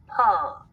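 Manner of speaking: "puh." a p plus a short "uh" vowel; added schwa. Shown as a demonstration. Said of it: "P sound was replaced with".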